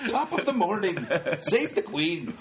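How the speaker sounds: high, hammed-up Irish-British accent; jokey mash-up of Irish and British accents. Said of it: "Irish, British, whatever".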